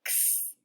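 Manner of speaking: x as a breathy unvoiced ks hiss; x sound. Shown as a demonstration. Teacher modelling the letter sound for kids, a whispered ks. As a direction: make x as a soft k then s, no voice. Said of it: "And then the sound is".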